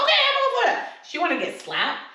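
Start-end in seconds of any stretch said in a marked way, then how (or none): 0.0-0.9 squealed high and loud, playfully teasing; a high excited squeal, mock enthusiasm; mock eager. Podcaster mocking a reality star's kink demands, squealing high. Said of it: "I was like".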